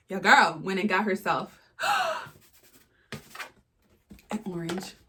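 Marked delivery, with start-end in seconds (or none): 1.8-2.2 sharp gasp that jumps very high; high excited gasp. Real, not acted. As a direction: gasp in delight at the box. Reveals orange Hermès box.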